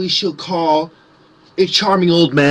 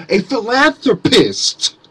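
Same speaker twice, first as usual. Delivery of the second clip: pitched up, drawn-out sneer on philanthropist; a lofty mocking sneer on the word; mock grand word; 5 semitones higher, slower than usual. Shown as a demonstration. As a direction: say philanthropist as a sarcastic flourish. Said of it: "That's how I would say it".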